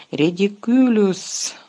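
slow, offhand, unbothered spell; lazily. Acted. Lupin facing the silver orb, casting lazily. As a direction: toss off the spell as if bored. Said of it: "ліниво вимовив Люпен".

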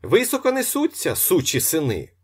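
loud, high-pitched indignant bluster. A narrator's reading. Locksmith blustering to the onlookers, loud and indignant. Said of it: "ще довго гороїжився".

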